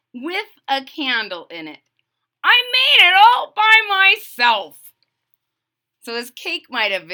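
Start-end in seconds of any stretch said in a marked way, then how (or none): 2.4-4.9 put-on high loud proud kid voice; proud Froggy. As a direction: boast to mum.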